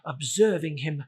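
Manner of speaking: plain reading voice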